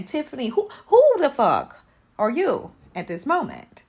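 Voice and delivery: outraged. Real, not acted. Outraged rant at Tiffany.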